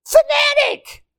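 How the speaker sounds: very high squeal on fanatic; squeaky fanatic. Acted. Preacher to congregation, high squeaky joke voice. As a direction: squeal the word like a cartoon. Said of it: "which is short for".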